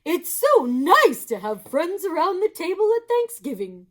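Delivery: high, loud, gushing hostess; Missus Moose voice. Acted. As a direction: gush at him, high and bright.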